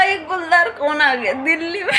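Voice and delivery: amused disbelief through laughter; laughing question. Retold or imitated. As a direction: ask it while laughing hard. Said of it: "मैंने कहा".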